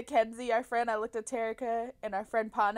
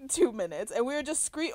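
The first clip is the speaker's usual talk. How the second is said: ordinary delivery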